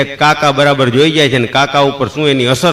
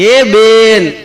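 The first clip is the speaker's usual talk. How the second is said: mock shout, much higher and louder; calling out; 7 semitones higher, 4 dB louder than usual. Uncle calls after the departing women, a hammed-up yell. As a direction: uncle hollering after the ladies. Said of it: "કાકાએ રાડ પાડી કે".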